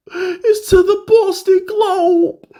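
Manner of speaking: outraged he went to the Boston paper, in a mock high squeal; incredulous squeal. Real, not acted. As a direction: squeak it out like you can't believe it.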